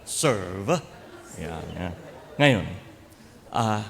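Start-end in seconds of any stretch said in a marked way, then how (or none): 0.1-0.8 mimicked British "Serve", rising at the end; British accent. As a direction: show off an exaggerated British "Serve". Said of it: "Pati yung forma, ganun". ("Serve" na ginagayang puntong Briton)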